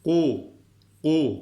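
loud, heavy "u" from far back; thick "u". Shown as a demonstration. Teacher models a thick letter, loud heavy "u". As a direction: hit a thick back "u" hard. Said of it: "Kalın harf".

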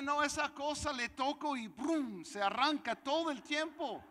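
loud, high exclamation building to a peak; imitated raving buyer. Buyer telling seller it always starts, loud imitated enthusiasm. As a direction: rave about it at full volume.

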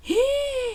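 sharp high gasp of shock; a sudden gasp of disbelief. Acted. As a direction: gasp at the news. Mother shocked by bakery income.

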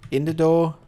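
called out high and loud, In the door. Real, not acted. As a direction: shout it across the yard to the animal. Calling animal, louder repeat.

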